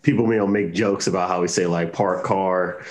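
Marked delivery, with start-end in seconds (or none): none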